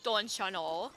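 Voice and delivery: playful Midwestern lilt, rising at the end; Midwestern accent. Acted. Streamer mocking own slip, hammed-up Midwestern accent. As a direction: exaggerate your Midwestern vowels. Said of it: "I sounded so Midwestern".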